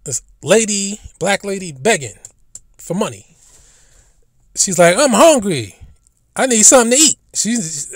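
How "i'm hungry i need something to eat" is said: louder, higher pleading; mock the beggar's blunt demand; a hint of a pleading beggar; imitating the beggar. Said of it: "that's her style of begging".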